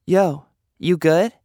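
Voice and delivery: high, slow, pointed check-in; Sweets checking in. Acted. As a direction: poke at him through the stall door. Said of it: "Sweets asks".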